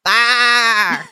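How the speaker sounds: long imitated lamb baa; sheep bleat. A narrator's reading. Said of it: "A plus goat and sheep voices".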